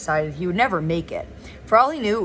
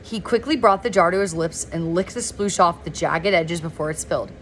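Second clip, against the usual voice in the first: plain reading voice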